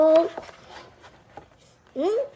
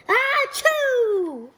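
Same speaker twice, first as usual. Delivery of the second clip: high sneeze; 6 semitones higher than usual. Acted. Gerald sneezing again, high. Another sneeze after worry.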